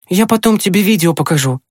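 put-on high boy's voice consoling a dog; promising boy. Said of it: "пообещал он". (обещание высоким мальчишеским голосом)

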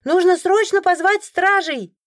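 high, loud and slow call; urgent shout. A narrator's reading. A townsperson demanding the guards be called, loud urgent shout. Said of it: "вдруг кто-то выкрикнул".

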